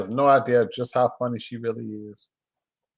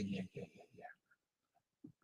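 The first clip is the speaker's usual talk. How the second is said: whisper; 5 semitones lower, 25 dB quieter, much slower than usual. Real, not acted. Playful repeated yeah, then whispered.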